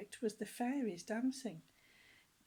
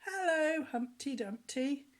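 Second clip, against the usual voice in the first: put-on higher, louder chorus of kids; children's reply; 5 semitones higher, 5 dB louder than usual. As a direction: answer back like a hall of children. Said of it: "the boys and girls said".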